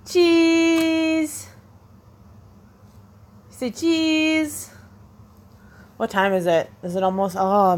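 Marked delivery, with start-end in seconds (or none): 0.1-4.7 singsong coaxing. Real, not acted. Babysitter getting a toddler to say cheese, singsong coaxing. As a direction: stretch the vowel out high.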